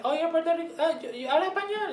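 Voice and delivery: high, loud, delighted voice of strangers; imitating eager Californians who recognize her accent; excited friendly greeting. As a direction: gush it out like a happy surprise. Californians greet her warmly in Spanish.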